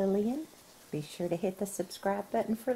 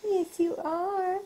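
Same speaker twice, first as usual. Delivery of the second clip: high, slow cooing; 11 semitones higher, slower than usual. Real, not acted. Mom cooing at the baby.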